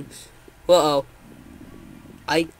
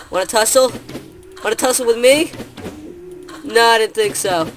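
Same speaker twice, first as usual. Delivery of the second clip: loud cocky taunt; 5 semitones higher, 5 dB louder than usual. Acted. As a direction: goad them into a fight. Taunting mobs to fight.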